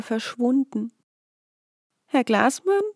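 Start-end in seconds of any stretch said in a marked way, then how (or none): 2.1-2.8 higher, rising questioning call; hesitant call. A narrator's reading. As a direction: call out unsure into the woods. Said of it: "rief nach einigem Zögern".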